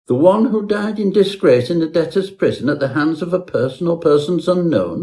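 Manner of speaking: high, keen questioning; leaning in, hungry for the scandal; a touch of collector's excitement; eager question. A narrator's reading. Eager collector asks about rake.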